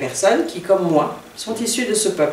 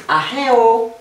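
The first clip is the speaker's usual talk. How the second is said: AHEO raised and held from the middle; higher, drawn-out AHEO with a stressed middle; stress on middle syllable; 5 semitones higher, 4 dB louder than usual. Shown as a demonstration. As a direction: lift the HE of AHEO higher and stretch it. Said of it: "avec l'accent tonique sur le".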